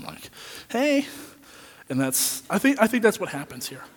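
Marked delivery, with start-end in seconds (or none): 0.7-1.1 fake friendly hey. Forced greeting to an estranged friend.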